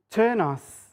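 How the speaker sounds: usual speaking voice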